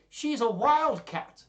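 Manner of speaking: very high, loud, drawn-out warning; hammed-up character alarm. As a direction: stretch it out in mock horror. Gremio warns about Katharina.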